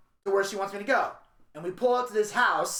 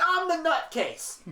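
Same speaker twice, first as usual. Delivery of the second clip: usual speaking voice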